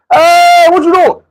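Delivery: high loud yell after him; coach shouting. Retold or imitated. Coach yells at the player walking off, high and loud. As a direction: holler at the quitting player.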